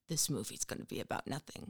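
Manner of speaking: low, quick, hushed aside; quiet translation. Retold or imitated. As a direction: murmur it fast under the breath. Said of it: "that's your nice way of saying".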